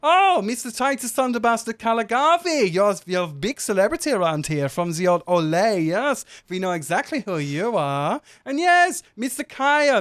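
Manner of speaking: high, loud gushing resort manager; effusive accented host. Acted. Tiny gnome manager welcoming famous guests to the resort, effusive. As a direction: fuss over celebrity guests in a high accented voice. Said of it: "and he goes".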